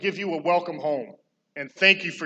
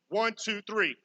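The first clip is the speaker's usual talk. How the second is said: usual speaking voice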